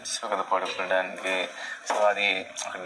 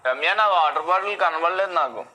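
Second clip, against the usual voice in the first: raised, higher-pitched call across to someone; 8 semitones higher, 4 dB louder than usual. Real, not acted. Calling to Ramya about a missing water bottle, calling out. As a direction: call out loudly for help finding it.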